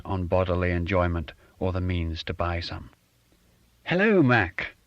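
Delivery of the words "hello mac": higher and louder, a curt put-on refined greeting; brisk, falsely refined hello; curt fake genteel. A narrator's reading. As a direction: greet him curtly in a fake genteel accent. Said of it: "in his curt tone and fake genteel accent".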